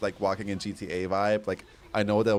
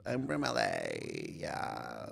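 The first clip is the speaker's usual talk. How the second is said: put-on very low, slow creaky valley girl; vocal fry; 12 semitones lower, 9 dB quieter, much slower than usual. Shown as a demonstration. Streamer doing a requested valley girl, vocal fry. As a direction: drop into deep vocal fry, softer and drawn out. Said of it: "like, vocal fry".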